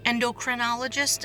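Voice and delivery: ordinary delivery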